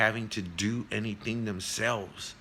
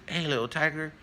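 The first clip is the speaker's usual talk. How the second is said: higher-pitched voice for a curious tiger; storyteller's acted tiger, pitched up; big tiger; 8 semitones higher than usual. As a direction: call out a puzzled question to the little tiger. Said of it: "he goes over to him and he says".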